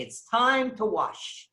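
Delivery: slow, drawn-out announcer call; formal announcement. Retold or imitated. Host announcing ritual washing to the guests, slow and formal. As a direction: proclaim it to the whole room. Announcement echoed flatly after.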